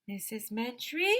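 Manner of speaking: high, rising questioning call; tentative call climbing in pitch; searching call. A narrator's reading. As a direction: call the name upward, waiting for an answer. Calling the missing woman again.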